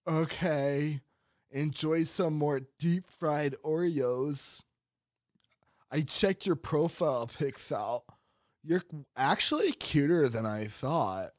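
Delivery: lightly voiced sleazy guy, mock flirty; sweaty incel voice. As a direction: stretch every word like a leering incel. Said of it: "sweaty incel voice".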